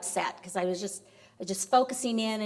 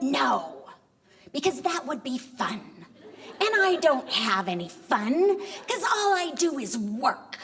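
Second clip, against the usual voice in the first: higher, emphatic mock tantrum; indignant refusal; 4 semitones higher than usual. Wife refuses husband's movie invitation, indignant.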